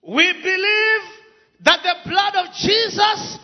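fiery sermon delivery; preaching. Acted. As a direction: preach it with full force. Preaching with interpreter.

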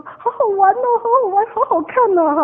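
put-on high, loud, gleeful child's voice; happy excitement. Child running home to tell the family, high gleeful voice. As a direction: bubble over with glee like a kid. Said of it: "就好高兴地说".

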